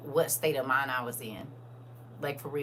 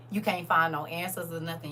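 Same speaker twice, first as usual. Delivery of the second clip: ordinary talk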